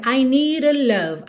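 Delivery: "need a" with a flapped "nee-ra"; tapped D. Shown as a demonstration. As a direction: link "need a" with a quick tap. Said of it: "Como fez o rarará no D".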